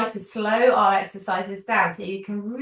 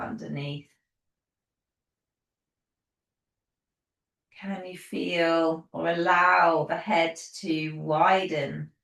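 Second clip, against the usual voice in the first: guided relaxation; 3 dB quieter than usual. Acted. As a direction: settle them gently into the body, unhurried. Leads a guided body relaxation.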